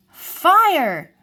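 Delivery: high, loud storyteller's cry; dramatic exclamation. A narrator's reading. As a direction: make the children jump.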